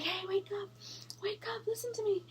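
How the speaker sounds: high, hushed mock wake-up call; urgent plea. Acting out trying to wake husband.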